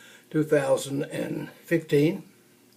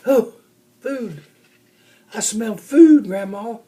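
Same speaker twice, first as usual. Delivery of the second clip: thrilled; 6 semitones higher, 7 dB louder than usual. Acted. Bear smells honey, thrilled.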